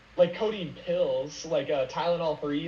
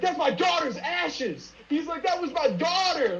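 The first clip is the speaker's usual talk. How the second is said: imitating frantic crying; 10 semitones higher than usual. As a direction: retell the man's panicked wail. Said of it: "started crying and freaking out".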